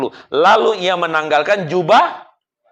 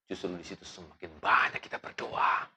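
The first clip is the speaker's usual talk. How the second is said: whispered urging; 7 semitones lower, 13 dB quieter than usual. Acted. Sermon whisper.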